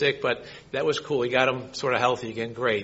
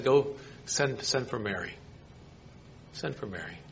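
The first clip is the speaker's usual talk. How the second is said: fading hush; 3 semitones lower, 11 dB quieter than usual. Acted. Jesus's words fading to a hush.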